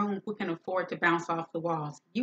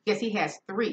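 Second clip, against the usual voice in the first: usual speaking voice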